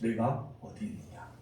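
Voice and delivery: very soft, low question; changed, gentle tone. Retold or imitated. God softening towards Adam after the shout, hushed gentle question. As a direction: drop right down and ask tenderly. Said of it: "그래서 바로 바꾸십니다".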